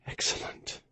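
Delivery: breathy whisper; dying man's faint approval. A narrator's reading. Dying admiral before coughing fit.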